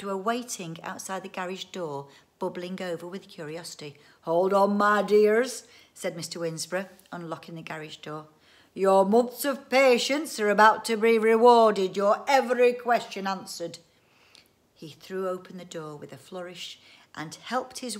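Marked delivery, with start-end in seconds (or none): 4.2-5.6 booming, showman-like call; loud calling out. Acted. Inventor to eager children at garage door, calling loudly. 8.7-13.8 loud, raised showman's proclamation; grand announcement. Acted. Inventor unlocking the garage for family, proclaiming loudly.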